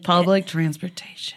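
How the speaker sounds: whispered longing. Real, not acted. Longing for Japan.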